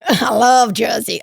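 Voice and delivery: put-on slow, heavy New Jersey accent. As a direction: lean into a New Jersey twang. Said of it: "That was my Jersey accent".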